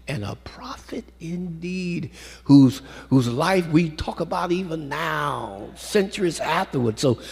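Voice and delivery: high, slow exalting tone; a lifted preaching voice. Acted. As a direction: lift the words in praise. Closing praise of God.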